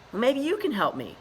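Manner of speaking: put-on high, loud chirpy young voice; bright eager greeting; cheerful beluga. Trapped beluga Dilly greets Ichabod, high and chirpy.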